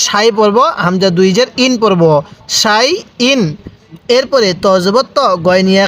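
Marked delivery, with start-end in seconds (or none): 2.6-3.4 "সাই", pause, "ইন", neither vowel stretched; no elongation. Shown as a demonstration. Teacher shows students where not to stretch, unextended "সাই ইন". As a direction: read "সাই ইন" as two separate units without madd. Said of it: "এখানে কোনো টান দিব না".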